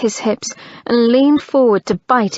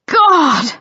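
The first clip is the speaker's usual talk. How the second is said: stifled shout; 7 semitones higher, 5 dB louder than usual. Acted. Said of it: "keep from shouting in ecstasy".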